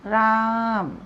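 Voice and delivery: राम with the आ held long; drawn-out pluta vowel. Shown as a demonstration. Teacher stretching राम for the pluta example. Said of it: "तीसरा है प्लुत स्वर".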